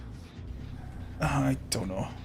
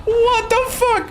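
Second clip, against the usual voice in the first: drawn out, pitched way up in disbelief; high slow squeal; slower than usual. Real, not acted. Streamer baffled by the vanished survivor, high slow squeal.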